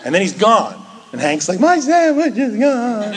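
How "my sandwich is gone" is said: much higher, slow, laughing lament; dismayed friend. Retold or imitated. Hank finding the dog ate his sandwich, comic high wail. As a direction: whine it like a betrayed friend, half laughing.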